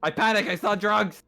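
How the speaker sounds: high, loud panicked outburst; jokey panicked yelp; mock panic. Mock panicked outburst among players.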